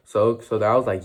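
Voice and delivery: ordinary delivery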